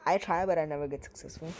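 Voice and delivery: usual speaking voice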